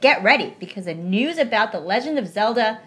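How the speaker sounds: upbeat radio-host delivery; podcast host read. Acted. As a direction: sell the news like a show host. Reading a podcast script aloud.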